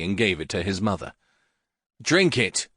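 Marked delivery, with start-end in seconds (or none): 2.0-2.7 a sharp, mildly fierce command; understated fierce son; said fiercely. A narrator's reading. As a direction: hand it over with a curt order. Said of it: "he said fiercely".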